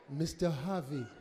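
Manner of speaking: laid-back, cool as ice; cool calm staffer. Retold or imitated. Cool African staffer voiced.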